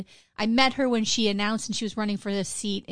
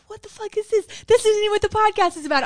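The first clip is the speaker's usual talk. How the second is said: much higher, indignant mock outrage; imitating a ranting reviewer; 10 semitones higher, 6 dB louder, faster than usual. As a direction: play the critic fed up with an off-topic show. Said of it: "And he's like".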